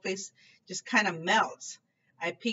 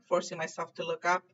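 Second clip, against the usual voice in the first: ordinary delivery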